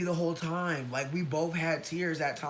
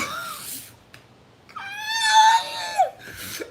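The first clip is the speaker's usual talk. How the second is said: long falling high wail; mock anguished wail; wailing scream; 12 dB louder than usual. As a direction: let out a hammed-up anguished wail. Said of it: "And I'm screaming it".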